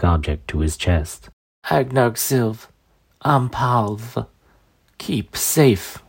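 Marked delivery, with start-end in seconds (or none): 1.7-6.0 slow, strained crone voice; dying old woman. Acted. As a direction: breathe the words out with effort.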